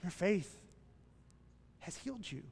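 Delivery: soft, tender blessing; soft reassurance to the healed woman; gentle Jesus. Retold or imitated. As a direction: say it tenderly, like a healing word. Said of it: "what did Jesus say to her".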